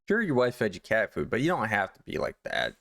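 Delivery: ordinary talk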